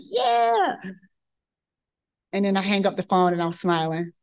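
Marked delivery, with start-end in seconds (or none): 0.0-0.8 triumphant yeah. Retold or imitated. Intern triumphant.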